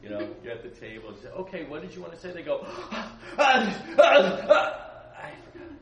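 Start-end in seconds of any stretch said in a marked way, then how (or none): none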